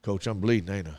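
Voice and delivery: put-on calm hurt kid. Bleeding boy calmly asking his coach, low and quiet. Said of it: "And he was like".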